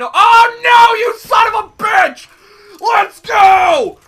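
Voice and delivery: loud high rage at the game; raging shout. Real, not acted. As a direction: yell it out in fury. Getting killed in game.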